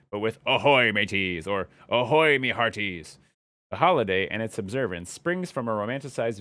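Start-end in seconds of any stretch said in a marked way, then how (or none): 0.5-1.5 slow, loud pirate greeting. Shown as a demonstration. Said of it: "everybody in the world should talk like a pirate". 1.9-3.2 slow, loud pirate greeting. Shown as a demonstration. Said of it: "everybody in the world should talk like a pirate".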